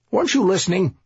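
lightly voiced smug police boss; confident sergeant. Sergeant scolds patrolman.

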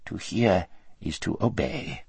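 a hint of a fawning vizier; servile vizier. A narrator's reading. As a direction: answer like a groveling minister. Vizier answers the Tisroc.

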